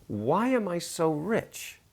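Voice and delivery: high, slow, soft wondering ask; dreamy, slow, marvelling; wondering question. Shown as a demonstration. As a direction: savor the question like a real wonder. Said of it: "what would the question be?".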